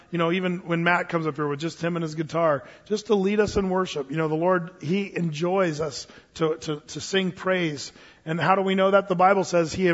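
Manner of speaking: ordinary delivery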